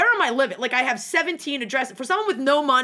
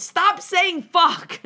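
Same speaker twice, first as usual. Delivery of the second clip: mom scolding; 8 semitones higher, 4 dB louder, much slower than usual. Retold or imitated. Mom scolding her swearing.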